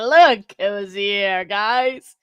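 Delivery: slow, loud, drawn-out welcome; excited greeting. Real, not acted. Host loudly greets the defendant showing up in chat, drawn out and excited. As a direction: stretch out the welcome as the guest appears.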